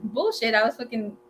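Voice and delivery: normal talking voice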